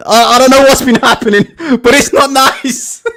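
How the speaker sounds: breaking into laughter on the words; barely getting it out through laughter; laughing while quoting. Real, not acted.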